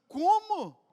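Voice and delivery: sudden high, loud Como?; a burst of disbelief; mock outraged gasp of a question; shocked question. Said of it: "Você fala assim".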